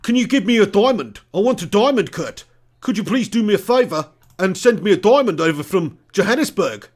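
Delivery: badly faked South African accent; attempted South African accent. Streamer asking Kurt for a diamond, in an attempted South African accent. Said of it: "What's South African, then?".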